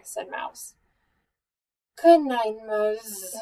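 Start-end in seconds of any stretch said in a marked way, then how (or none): 2.0-3.3 blurred goodnight sagging after the first word; sleepy mumble. A narrator's reading. Bear yawning after the story, sleepy mumble. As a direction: let the last word slur away. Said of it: "Bear mumbled".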